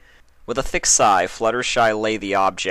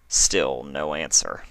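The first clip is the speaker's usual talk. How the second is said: ordinary delivery, nothing marked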